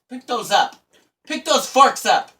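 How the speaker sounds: loud, high acted order; exasperated play-acted telling-off; mock scolding. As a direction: give a hammed-up angry command, building. Acted scolding over dropped forks.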